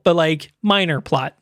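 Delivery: ordinary delivery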